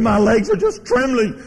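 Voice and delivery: high-pitched imitation of a distraught woman; retold trembling distress. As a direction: stammer it high and urgent, as if overcome. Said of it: "her lips began to quiver".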